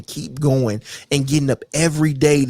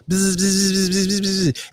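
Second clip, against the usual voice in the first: lips buzzing like a gnat in your ear; buzzing fly noise; slower than usual. Retold or imitated. Imitating an annoying fly buzzing in the ear, buzzed. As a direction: make the pest buzz.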